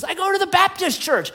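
high-pitched acted call; hanging man answering.

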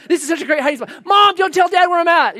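high, loud toddler impression; excited little kid. Two-year-old calling to mom from hiding spot, excited shout. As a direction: build to a mock-child shout to mom.

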